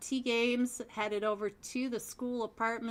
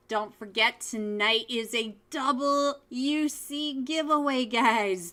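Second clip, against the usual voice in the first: louder upbeat promo call to chat; hyped reminder; 3 semitones higher, 6 dB louder than usual. Real, not acted. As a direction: pump up the giveaway for the viewers. Hype announcement to stream viewers.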